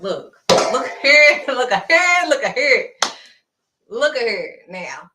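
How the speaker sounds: hammed-up "look here", leaning on each one; exasperated look here. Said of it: "And I'm like".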